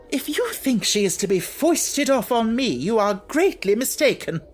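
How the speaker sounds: high, loud outraged protest; furious gasp. Acted. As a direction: spit it out in outrage. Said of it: "Miss Minchin gasped".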